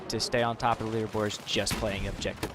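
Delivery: normal talking voice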